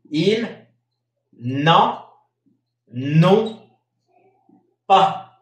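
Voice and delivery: ils, n'en, ont, pas said slowly one by one with pauses; liaison demo. Shown as a demonstration. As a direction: break Ils n'en ont pas into separate words for the class. (pronunciación palabra por palabra de ils n'en ont pas)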